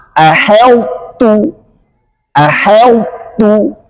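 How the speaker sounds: ahautu slow, the merged ta heavy; correct ahautu. Shown as a demonstration. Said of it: "kata yang kedua".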